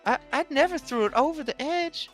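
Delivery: high, upset husband voice; a worried, whiny husband pleading innocence. Acted. GM voicing NPC partner.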